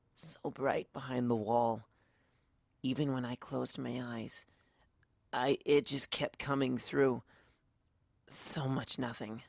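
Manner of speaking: slow, soft, faraway murmur; dazed murmur. Acted. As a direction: drift through the words half-asleep. Twilight dazed after nightmare.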